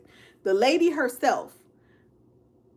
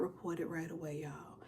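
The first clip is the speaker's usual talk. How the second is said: hushed, breathy aside with no voice; whisper; 5 semitones lower, 18 dB quieter than usual. Real, not acted. Whispering a juicy twist to her stream viewers.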